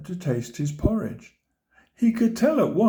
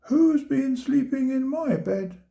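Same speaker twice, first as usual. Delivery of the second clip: mother bear voice; 10 semitones higher than usual. A narrator's reading. Mother bear's turn to speak.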